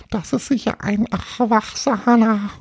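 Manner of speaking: AWACHSANA with open "a" sounds for Erwachsener, high voice; mispronouncing ghost. Acted. Little ghost fears a grown-up coming, high mispronounced "Awachsana". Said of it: "Meinst du ein Erwachsener?".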